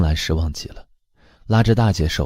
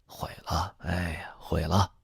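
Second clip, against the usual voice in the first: whispered, much softer sigh; hushed lament; 2 semitones lower, 11 dB quieter, slower than usual. A narrator's reading. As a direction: breathe it out like a dismayed aside. Mother lamenting her ugly baby. (低声叹息)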